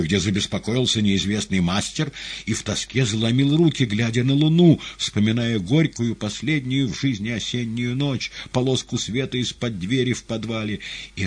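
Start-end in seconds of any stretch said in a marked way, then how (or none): none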